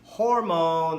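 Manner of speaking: ordinary talk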